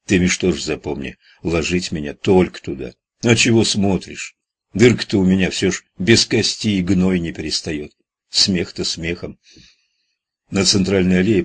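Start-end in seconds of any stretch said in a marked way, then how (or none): none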